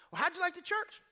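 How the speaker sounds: high, loud eager questioning; mock eager dinner host; eager church guys. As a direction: pepper him with keen questions.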